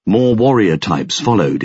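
plain reading voice

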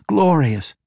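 high, loud exultant glorious; ecstatic. Acted. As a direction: cry glorious in rapture. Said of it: "clapped them excitedly".

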